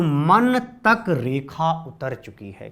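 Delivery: drawn-out teaching recital, word by word; slow mnemonic line. Shown as a demonstration. Teacher reciting the mnemonic to students, slowly. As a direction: spell it out slowly for the class. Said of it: "देखिएगा".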